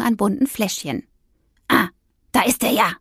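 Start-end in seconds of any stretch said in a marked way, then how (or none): 1.7-2.9 mildly delighted; light up on spotting it; pleased discovery. A narrator's reading. Witch finding her potion bottle, mildly pleased. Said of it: "freute sie sich".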